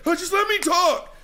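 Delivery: high, loud mocking whine of Craig. Host mocking Craig's plea to be heard, high and whiny. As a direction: squeak it out like a petulant guest. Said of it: "He's like".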